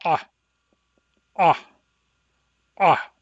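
tight strangled ah catches; pharyngeal stop. Shown as a demonstration. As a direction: choke off each ah in the throat. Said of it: "It sounds like this".

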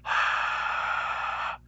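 a soft unvoiced sigh with stiff cords; voiceless breath out. Shown as a demonstration. Teacher demonstrating the H breath to students, voiceless sigh. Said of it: "it sounds like this".

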